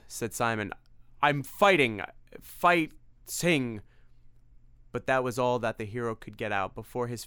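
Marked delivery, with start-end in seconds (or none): 1.2-3.8 straining struggle. Acted. Simon struggling against possession.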